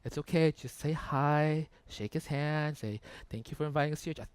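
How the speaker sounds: low, soft coaxing; reassuring wife. Retold or imitated. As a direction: talk a panicking husband down, quietly. Wife calms down panicking husband.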